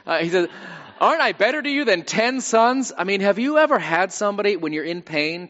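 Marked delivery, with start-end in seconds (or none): 1.0-2.9 high-pitched, self-assured mock consolation; well-meaning husband. Husband consoling his childless wife, cluelessly pleased with himself. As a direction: play the oblivious husband pleading his own worth. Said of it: "He means well".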